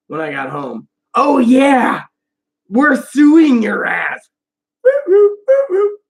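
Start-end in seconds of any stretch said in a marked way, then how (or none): 1.1-4.3 loud, drawn-out acted threat; defiant threat. Threatening to sue over Rita's treatment, defiant threat. 4.8-6.0 very high, loud repeated whoops; high whooping. Retold or imitated. Celebrating the threat to sue, high whooping.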